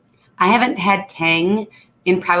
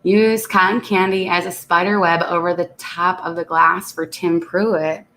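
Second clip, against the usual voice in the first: usual speaking voice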